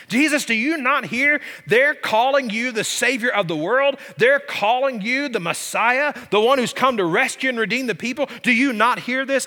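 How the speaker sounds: high-pitched, insistent questioning; indignant. Retold or imitated. Priests challenge Jesus over the children's praise, indignant. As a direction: press him, incredulous, as in a retold challenge. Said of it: "they became indignant and said to him".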